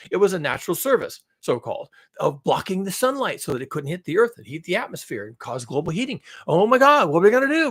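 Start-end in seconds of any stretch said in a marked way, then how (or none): 6.5-7.7 loud, high mock panic; sarcastic panic. Mocking alarm over cleaner shipping, sarcastic panic. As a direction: wail it as a hammed-up worrier. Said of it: "I'm being sarcastic here".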